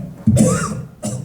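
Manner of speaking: sharp breathy cough; mock coughing fit. Retelling his first puff of a joint, mock cough.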